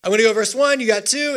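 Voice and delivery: ordinary talk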